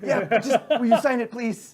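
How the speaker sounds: high, loud fanboy begging; eager pleading. Acted. Guard begging idol for autograph, eager. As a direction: plead it with a laugh in the voice.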